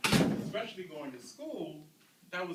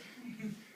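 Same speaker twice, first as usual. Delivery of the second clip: a quick, higher laugh; short laugh; 7 semitones higher, 4 dB quieter than usual. Real, not acted. Dad laughing at his son's worry. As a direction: laugh off the tension. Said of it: "So I started laughing".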